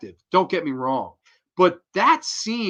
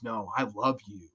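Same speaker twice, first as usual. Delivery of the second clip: low seductive murmur; 6 semitones lower, 9 dB quieter than usual. Retold or imitated. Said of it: "she goes".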